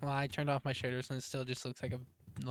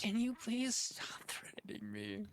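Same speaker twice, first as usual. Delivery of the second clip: whispering; 4 semitones higher, 5 dB quieter than usual. Real, not acted. Threatened, whispering plea.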